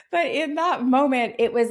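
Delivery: high, bright burst of laughter; laughing joy spilling over; delighted laugh. Real, not acted. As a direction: laugh through the words. Laughing remembering twins news.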